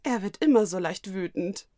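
usual speaking voice

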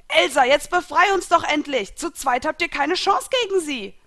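high, loud, exasperated battle yell; urgent shouting. A narrator's reading. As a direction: yell for help across the fight. Friend yells to Elsa in battle. (drängend laut gerufen)

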